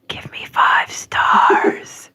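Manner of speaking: slow whispered plug; slow conspiratorial whisper, teasing. Pretend. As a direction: slip it in as a sneaky whisper.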